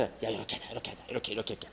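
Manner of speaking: very soft, low mutter; barely audible, plotting into someone's ear; imitated conspiratorial whispering. Preacher plays a schemer whispering a plan, very hushed. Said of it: "꾀 속닥속닥해".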